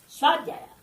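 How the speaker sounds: normal talking voice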